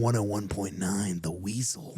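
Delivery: slow, drawn-out mock DJ announcement; radio station ident. Co-host fills an awkward pause, mock radio DJ voice.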